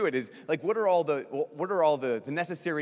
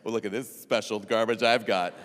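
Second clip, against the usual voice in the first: hammed-up pride, swelling much louder; mock boasting; 3 semitones lower, 8 dB louder than usual. Priest mocking people proud of their garbage, mock boasting. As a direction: show it off with mock pride, building loud. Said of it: "You don't do this with your garbage".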